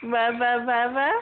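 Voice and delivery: water said twice as wava, the t turned to v and the final r dropped; baby-talk wava. Retold or imitated. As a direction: echo the toddler's wava for water. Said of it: "Can you say water?".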